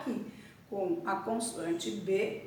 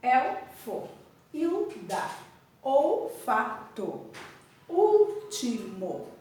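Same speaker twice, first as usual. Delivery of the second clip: olfato and último stretched out slowly syllable by syllable; teacher reading example words aloud to pupils; slow syllabified reading; 2 semitones higher, slower than usual. Shown as a demonstration. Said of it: "Aqui eu tenho".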